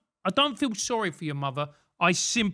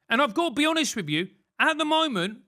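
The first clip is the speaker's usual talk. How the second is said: usual speaking voice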